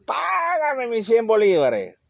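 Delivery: high, slow menacing demand; choking demand. Acted. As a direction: squeeze the words out as you throttle him. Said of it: "Lo agarró por el cuello".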